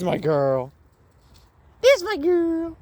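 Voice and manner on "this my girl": high squeaky dog praise. Real, not acted. Cooing to dog.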